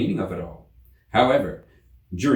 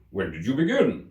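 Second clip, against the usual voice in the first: the king; 3 semitones higher than usual. A narrator's reading. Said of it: "said the king".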